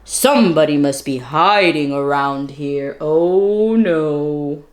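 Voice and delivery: a low guard sure someone hides; night watchman. Acted. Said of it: "he exclaimed".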